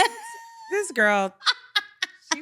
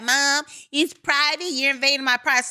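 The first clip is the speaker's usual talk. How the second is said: high, loud mock teen protest; defensive child; 8 semitones higher, 4 dB louder than usual. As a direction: imitate an indignant kid whining at mom.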